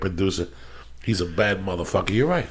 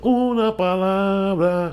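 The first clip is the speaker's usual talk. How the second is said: a hint of a menacing villain voice, higher and slow; movie villain line; 9 semitones higher, slower than usual. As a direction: lightly mimic the film character's cold menace, slowly. Quoting the killer's line in the film.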